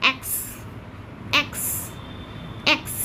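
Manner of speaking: "এক্স" with a sound between e and a, then k-s; "এক্স" said as eks, spaced; letter X drilled. Shown as a demonstration. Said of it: "এ আর এ এর মাঝে মাঝে উচ্চারণটা".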